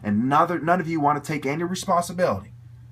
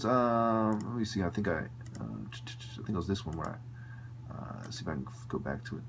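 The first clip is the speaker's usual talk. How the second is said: much softer and lower, fast, trailing into a whisper; muttering to himself; 6 semitones lower, 9 dB quieter than usual. Real, not acted. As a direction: mumble off-mic while hunting for the clip. Muttering while searching for clip.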